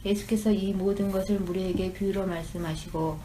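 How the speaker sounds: ordinary delivery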